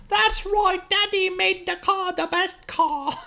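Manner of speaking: put-on high, slow hammy Cockney kid; terrible Cockney accent. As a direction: overdo a bad Cockney child accent.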